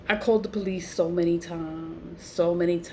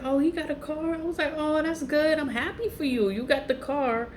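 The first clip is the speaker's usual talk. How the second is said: much higher, sing-song fake delight; mock cheerful; 9 semitones higher than usual. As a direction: gush with put-on joy. Retold fake happy reaction.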